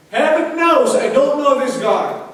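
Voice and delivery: high-pitched, insistent acted denial; swearing an oath. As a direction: swear it to the heavens. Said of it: "he took an oath".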